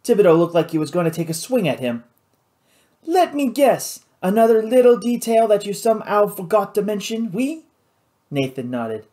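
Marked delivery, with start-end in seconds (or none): 3.0-7.6 high-pitched, sarcastic Cajun pirate; exasperated pirate boss. Acted. Louis rounding on Nathan over a forgotten detail, exasperated. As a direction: draw out the opening, exasperated.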